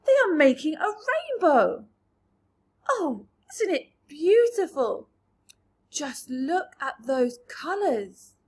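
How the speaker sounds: loud, high, wonder-struck voice; thrilled by the colours, calling out loud; awed excitement. A narrator's reading. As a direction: gasp it out in delight. Travellers marvel at the rainbow.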